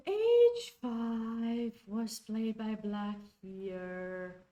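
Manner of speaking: slow, loud, stretched words of disbelief; dragged-out stunned announcement. Real, not acted. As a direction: drag each word out in shock. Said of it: "I'm sorry I'm talking like that".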